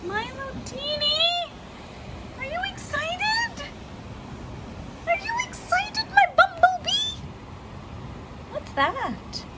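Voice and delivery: put-on high, sing-song baby voice; baby talk. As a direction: coo at the little one like a parent. Baby talk to Milo during storm.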